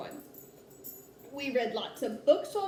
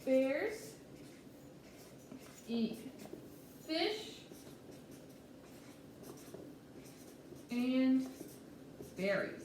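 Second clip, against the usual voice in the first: slow dictation; 9 dB quieter, slower than usual. Shown as a demonstration. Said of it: "So I might say".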